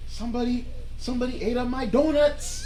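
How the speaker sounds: high, loud whiny complaint as the big coworker; upset coworker. Retold or imitated. Coworker complaining that his donuts were eaten, high and whiny. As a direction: mimic his wounded outrage, pitched high. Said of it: "he said".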